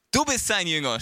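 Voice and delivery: mild narrated yell; shouted accusation. A narrator's reading. Leaders accusing the healed man, mildly shouting. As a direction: raise the voice slightly to accuse. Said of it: "schrien sie ihn an".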